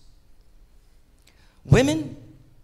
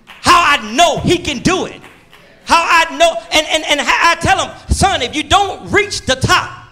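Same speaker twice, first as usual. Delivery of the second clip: raised preacher's call; preaching; 10 semitones higher, 5 dB louder than usual. Acted. Preacher calling out to the congregation, raised.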